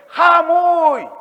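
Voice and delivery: high loud call of Jamuy. Shown as a demonstration. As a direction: call Jamuy across the fields. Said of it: "A ver, escuchen".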